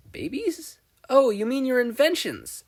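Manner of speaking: high, puzzled question; baffled, rising question. A narrator's reading. Izuku puzzled by word.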